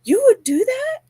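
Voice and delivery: retold squeak of surprise; excited disbelief. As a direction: mimic the teen's delighted amazement. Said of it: "She was excited".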